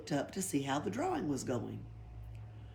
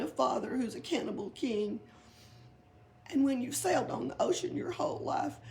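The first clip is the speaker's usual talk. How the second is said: trembling; 8 semitones higher than usual. A narrator's reading. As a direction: plead with a quavering voice. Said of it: "said in a trembling voice".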